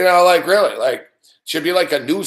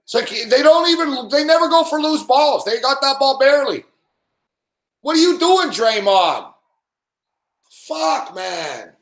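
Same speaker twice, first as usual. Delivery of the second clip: frustrated yelling; 10 semitones higher than usual. Real, not acted. Yelling at team missing loose balls.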